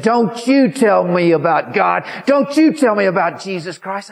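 mock-indignant scolding, high and loud; angry outburst. Old woman warns off the preacher, angry outburst.

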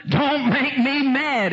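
high, stretched-out comic protest; exaggerated retold indignation; mock indignant retort. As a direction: ham up the warning, pitched high. Repeats husband's reply, hammed up.

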